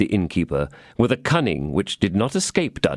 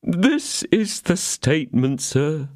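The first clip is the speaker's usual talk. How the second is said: trembling landlord; 7 semitones higher, slower than usual. A narrator's reading. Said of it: "said the trembling landlord".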